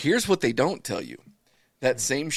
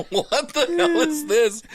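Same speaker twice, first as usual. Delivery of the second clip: high squeaky laugh-cry through the words; slow, high, laughing outcry; laughing disbelief; 7 dB louder, slower than usual. Retold or imitated. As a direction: crack up while asking what this is.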